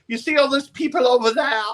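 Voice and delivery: campy promoter. Retold or imitated. Promo man voiced again.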